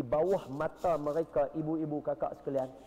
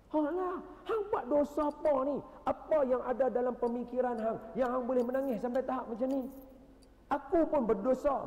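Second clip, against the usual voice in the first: much higher and louder, pressing the stranger; amazed questioning; 12 semitones higher, 5 dB louder than usual. Retold or imitated. Barsisa pressing the weeping man about his sins. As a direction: demand to know his sin, astonished.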